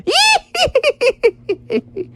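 very high cackle trailing off; high tittering laugh dying away; fading eel laughter. Acted. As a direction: laugh in falsetto, fading into the distance. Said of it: "his laughter faded into the distance".

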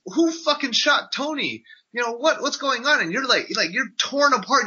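ordinary talk

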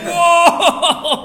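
loud, high whoop of shock; big retold yell of astonishment; shocked reaction. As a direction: burst out in mock disbelief. Acting out shocked reaction to line.

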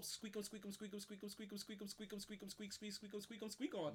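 rapid, rhythmic squeaking bed noise on one pitch; bed squeak imitation. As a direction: rattle off squeaks like bouncing bedsprings. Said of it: "The sound the bed makes".

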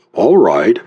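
usual speaking voice

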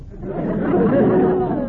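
a short amused laugh; chuckle, tickled by the idea. Acted. Luigi laughs at his joke.

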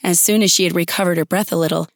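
ordinary delivery, nothing marked

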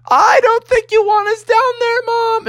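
put-on high, strained kid voice calling out; calling back. Bubby warning his mother from upstairs, high calling voice. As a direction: call it to Mom in another room.

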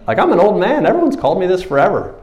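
hammed-up indignant old man; old man's protest. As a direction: play a startled old man who's been renamed, fast. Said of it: "And you're like".

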